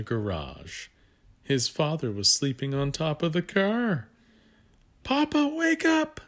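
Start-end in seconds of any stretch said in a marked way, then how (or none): 5.0-6.2 put-on very high, loud child's cry; childish yell. Jason yells to wake his father, high loud cry. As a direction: shout it up like a small boy. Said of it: "Jason yelled".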